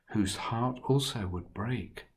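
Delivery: ordinary delivery, nothing marked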